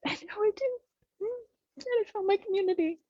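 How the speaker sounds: fighting back real tears; choked-up, breaking voice; teary. Real, not acted. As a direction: voice cracking up high with tears. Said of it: "Sorry, I'm getting really teary".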